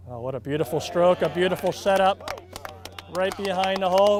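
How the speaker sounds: live golf call, rising to praise; golf commentator. Real, not acted. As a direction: call the putt as it drops.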